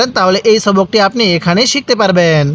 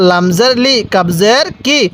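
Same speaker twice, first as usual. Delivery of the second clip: normal talking voice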